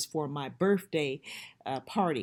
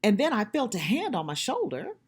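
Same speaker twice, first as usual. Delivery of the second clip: ordinary talk, nothing marked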